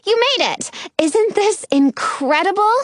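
high, excited welcome; delighted greeting. A narrator's reading. Penny greets friends excitedly.

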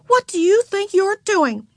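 high, indignant demand; exasperated exclamation. A narrator's reading. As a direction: scold a little sister in a high voice. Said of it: "exclaimed Beezus".

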